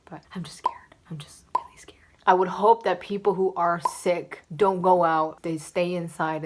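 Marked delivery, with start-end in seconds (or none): 0.1-2.0 hushed nervous confession; whispering. Real, not acted. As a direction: whisper the worry softly.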